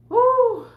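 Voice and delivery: high, loud whoo; loud exhaling whoo after a drink; whoo whoop. Real, not acted. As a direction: let out a sharp high whoop. Reacts to gulp of energy drink.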